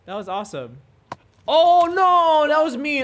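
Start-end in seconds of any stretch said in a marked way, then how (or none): 1.5-3.0 louder, higher oh no; upset outburst; loud dismay. Real, not acted. Seeing a dog push a kid, loud dismay.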